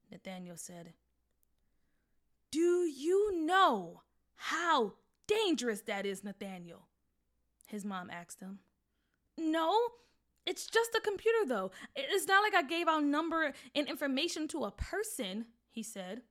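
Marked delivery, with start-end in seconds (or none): 2.5-6.9 alarmed mom. Acted. 9.4-15.5 put-on protesting boy. Son protests.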